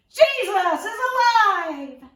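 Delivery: high, loud, jubilant shout; joyful proclamation. Acted. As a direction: cry out the good news. Mary telling friends joyful news.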